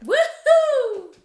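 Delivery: high whooping cheer. Real, not acted. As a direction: whoop with joy. Celebratory cheer.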